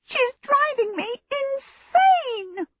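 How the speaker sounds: shrill, very high, drawn-out wail; small djinn at the end of his tether; silver-trumpet djinn voice. A narrator's reading. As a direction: trumpet it out in exasperation. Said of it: "he had a voice like silver trumpets".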